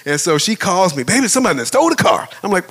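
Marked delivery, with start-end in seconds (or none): 1.0-2.3 high, fast, loud imitated alarm; upset wife. Wife calls her husband about the car, imitated upset. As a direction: blurt it out like a panicked caller. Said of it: "she's all upset".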